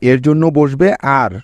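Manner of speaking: usual speaking voice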